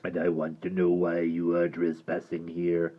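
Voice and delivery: low, soft gravelly rasp; deep creature voice. Acted. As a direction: ask it low and menacing. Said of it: "said the hideous creature".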